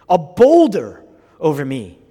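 loud, slow burst on boulder; emphatic proclamation. Acted. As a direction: hit the big word hard and draw it out. Preacher's climax as Jesus.

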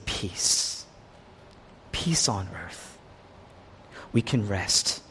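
soft, low and slow; quiet, restful, low-voiced reassurance; hushed peace. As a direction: let it settle gently into a hush. Contrasts hushed peace with loud proclamations.

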